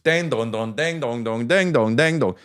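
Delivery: sung ding dong rising at the end; mock horror sound effect, climbing up; Michael Myers sit-up noise. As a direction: chant the creepy movie sit-up sound. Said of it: "he just".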